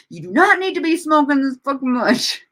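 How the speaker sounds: hammed-up self-reprimand to an imagined Amber; mock self-scolding. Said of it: "scold myself".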